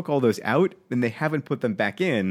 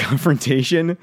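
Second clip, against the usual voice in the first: ordinary talk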